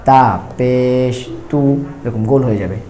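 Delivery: drawn-out teacher recitation of letters; slow letter drill. Shown as a demonstration. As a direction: chant each letter slowly for students.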